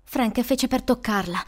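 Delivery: ordinary delivery, nothing marked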